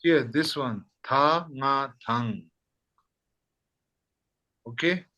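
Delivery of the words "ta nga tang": "Ta, nga" said as separate parts, then joined as "tang"; lower, measured sounding-out of "Ta, nga, tang"; spelled-out syllables. Shown as a demonstration. Teacher shows student where it is, "Ta, nga, tang" sounded out. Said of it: "Can you say it again".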